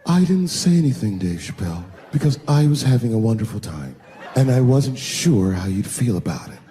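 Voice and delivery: lightly voiced sultry partner; a hint of a sultry purr. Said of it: "Then I heard that sultry voice".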